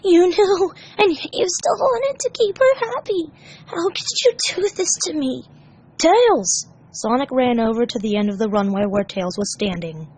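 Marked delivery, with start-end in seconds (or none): 0.0-5.4 tearful hurt. Acted. Tails crying, betrayed. 6.0-6.7 high, loud call. Acted. Sonic calling out.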